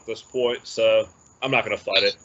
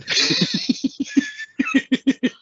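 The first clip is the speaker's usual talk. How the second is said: high-pitched burst of laughter. Real, not acted. Friend laughing at his own weight gain, high burst.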